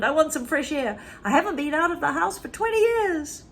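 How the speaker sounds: put-on high, eager old woman's voice; Grandma longing for fresh air; exulting Grandma. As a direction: cry out for freedom, high.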